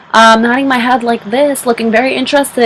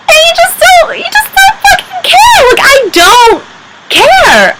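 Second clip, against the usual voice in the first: very high, strained outburst; exasperated high wail. Real, not acted. Exasperated rant about not caring.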